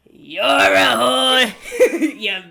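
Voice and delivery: slow, loud ranting insults; ranting lady. Retold or imitated. Quoting the market lady's slow, loud ranting at mom. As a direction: drag out each insult loudly like the unstable lady. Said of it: "the lady goes".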